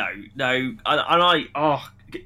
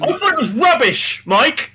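much higher, loud shout of disgust; loud, high-pitched rant that the movie was rubbish; exasperated outburst; 8 semitones higher, 5 dB louder than usual. Real, not acted. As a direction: blurt out the verdict in a near-yell. Bursting out with verdict.

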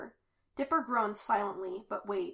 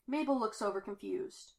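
usual speaking voice

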